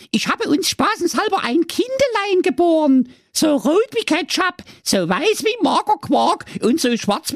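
high, far-off Saxon wife voice; squeaky proud housewife showing her bundle; wife's voice. Acted. Wife Waltraud showing husband the baby, high squeaky voice. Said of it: "Doch die Waltraud erwiderte".

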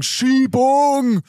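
high booing crowd yell; crowd shouting. Pretend. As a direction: shout it like an angry audience. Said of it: "dann werden alle sagen". (wütend buhende Menge)

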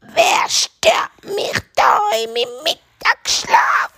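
high, slow, screechy witch voice; cross witch. Acted. Witch woken from midday nap, screechy and cross. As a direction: screech slowly at whoever woke you.

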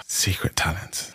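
breathy whisper, slow and thoughtful; whispered musing. Real, not acted. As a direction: whisper it to yourself, pondering. Whispers the question, pondering.

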